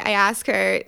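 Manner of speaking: ordinary delivery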